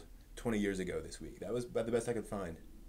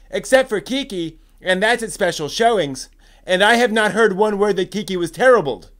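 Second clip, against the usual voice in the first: higher, louder, worked-up reply with shouted bits; indignant rebuttal; 13 semitones higher, 18 dB louder than usual. Acted. Host voicing a fan who angrily rebuts the first poster. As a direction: scold the poster for jumping the gun.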